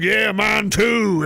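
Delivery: hammed-up Old West drunkard; pissed-off drunk cowboy. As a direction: drag it out like a saloon drunk. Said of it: "pissed off looking and drunk all the time".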